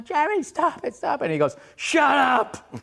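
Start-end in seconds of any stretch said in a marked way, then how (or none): 0.0-1.3 high, urgent mock shriek; imitated frantic wife; pleading wife impression. Wife pleading during the neighbor fight. 1.8-2.7 loud, high imitated shout; retold furious outburst; angry husband yell. Husband snapping at his wife.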